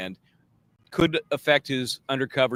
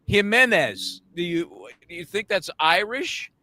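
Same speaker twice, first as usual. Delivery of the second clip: raised, incredulous mock retort; sarcastic witness retort; 8 semitones higher, 5 dB louder than usual. Retelling the witness answering the lawyer, incredulous and raised. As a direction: act out his baffled comeback, eyes rolling.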